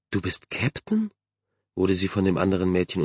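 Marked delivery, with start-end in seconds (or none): 0.1-1.1 soft, slow, high question; small voice, pitched up and softer; timid amazement. A narrator's reading. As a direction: ask in hushed disbelief. Shy girl interrupts in amazement.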